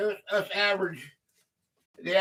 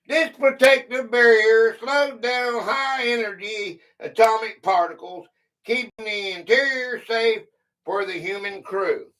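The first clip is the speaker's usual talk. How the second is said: reading a quiz clue; 5 semitones higher, 3 dB louder than usual. Acted. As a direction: clear read-aloud quiz voice. Reads a quiz clue aloud.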